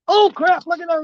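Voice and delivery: high, sudden startled call; surprised. Real, not acted. Fisherman to viewers, startled by a sudden bite. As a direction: react fast as the rod jerks.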